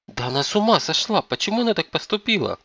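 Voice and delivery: high-pitched, aghast questioning; appalled daughter's outburst; shocked exclamation. A narrator's reading. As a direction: exclaim in disbelief, pitch climbing.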